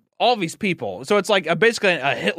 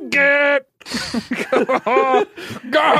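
higher, louder half-laughed cry; choked mock crying; 8 semitones higher, 4 dB louder, much slower than usual. Obi-Wan leaving Anakin, mock tearful stammer. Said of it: "And he's like".

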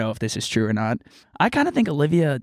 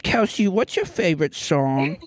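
put-on baby talk; 2 semitones higher, slower than usual. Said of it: "they're not baby talkers".

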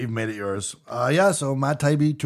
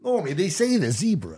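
higher, botched sitcom impression sounding Australian; Cliff Clavin; 5 semitones higher than usual. Retrying the Cheers impression for doubting friends, Australian-sounding character voice. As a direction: do a bad character voice that slips into Australian. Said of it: "You sound like an Australian".

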